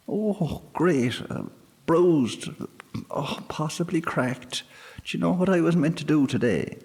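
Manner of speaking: high fairy voice, wincing then rushing; hurt fairy moaning about his ribs; pained sprite. A narrator's reading. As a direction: groan then complain fast. Sprite winces in pain.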